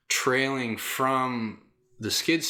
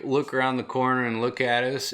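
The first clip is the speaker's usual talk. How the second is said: ordinary delivery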